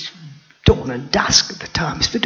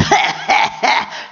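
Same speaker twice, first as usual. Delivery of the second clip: breathy mocking hyena cackle; hyena laugh; 7 semitones higher than usual. Hyena laughing at the trapped lioness, mocking cackle. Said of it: "with his stupid laugh".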